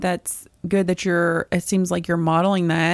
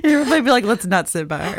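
amused, talking through laughter; laughing; 2 semitones higher, faster than usual. Real, not acted. Friend teasing about the air horn mom, laughing. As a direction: joke while laughing along.

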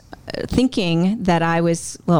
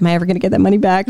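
fast, fretful question; rushed worry; 3 dB louder, much faster than usual. Retold or imitated. Young saver asking mom about her money, fast and fretful. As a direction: rattle it off like a kid fearing lost money. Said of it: "And I was like".

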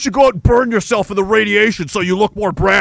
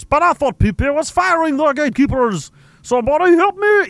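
higher, whiny mock complaint; mock whiny chatter; 7 semitones higher than usual. Streamer mocks a complaining viewer, high and whiny. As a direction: whine like a helpless viewer.

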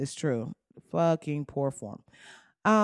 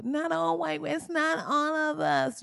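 high, slow whiny-protest imitation; 9 semitones higher, 3 dB quieter than usual. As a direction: lift the voice way up, drag out the protest.

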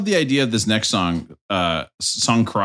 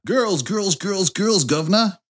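higher mock London accent slipping away; fading London accent; 8 semitones higher, slower than usual. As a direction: try the London accent as it drifts off. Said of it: "the accent a little bit".

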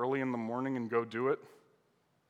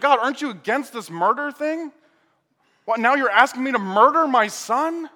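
higher and louder, protesting to God in disbelief; outraged Abraham; 8 semitones higher, 8 dB louder than usual. Acted. Abraham protesting to God over his son, outraged loud shout. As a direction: rail at God like a father told to kill his son.

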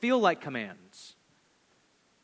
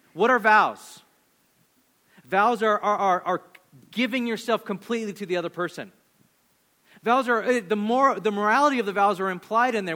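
louder, driving sermon voice; full-force preaching; 3 semitones higher, 5 dB louder than usual. Real, not acted. Pastor explaining vows to congregation, loud preaching. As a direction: hammer the point home to the crowd. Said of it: "preaching at full force".

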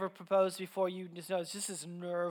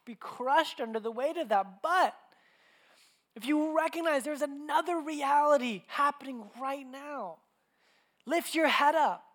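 raised, higher exhorting voice; impassioned preaching; 5 semitones higher, 4 dB louder than usual. Acted. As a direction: urge the congregation as an apostle would. Voices Paul exhorting the Ephesians.

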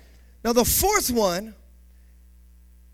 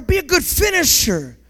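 usual speaking voice

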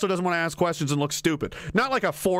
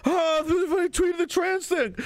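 higher-pitched, put-upon mock voice; mock hapless politician; 9 semitones higher, slower than usual. Politician who tweeted to get fired, mock helpless.

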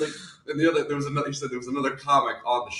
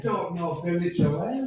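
slow, put-on Irish voice; Irish accent; slower than usual. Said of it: "that good at an Irish accent".

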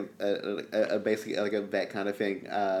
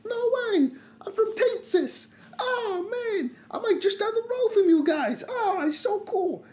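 excited. Acted. Over-the-top excited character.

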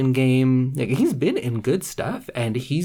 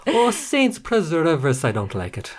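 lightly put-on Irish accent, higher on the opening cry; old Irish lady; 5 semitones higher than usual. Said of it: "an old Irish lady".

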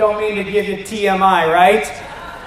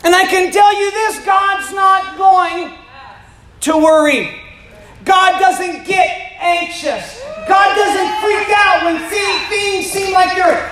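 preaching at full force; 8 semitones higher than usual. Acted. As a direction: lift the room with rising conviction. Preaching climax.

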